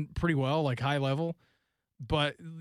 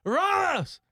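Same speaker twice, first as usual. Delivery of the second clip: high, over-the-top soccer call; drawn-out, hammed-up name shouts; Gus Johnson; 4 dB louder, much slower than usual. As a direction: belt out star names like an overexcited commentator. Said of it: "when he called Champions Leagues game and he's like".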